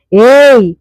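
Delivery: loud, high roar of Hey; roaring shout. A narrator's reading. Husband roars at the aunt to stop her, loud furious shout. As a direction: bellow it to silence her. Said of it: "என்ற கர்ஜனையில்".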